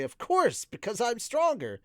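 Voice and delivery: high, loud, puffed-up boast; Eris, boastful. A narrator's reading. Swordswoman crowing over her win, boastful. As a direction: brag with your chest puffed out. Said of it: "puff out her chest, and say".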